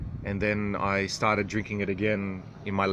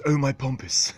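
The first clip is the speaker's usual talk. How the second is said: slightly higher mock exclamation; a lightly put-on, raised-pitch exclamation; Pompous's catchphrase; 4 semitones higher than usual. Quoting Pompous's own version of the oath, mock exclamation. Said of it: "Pompous doesn't say ... He says".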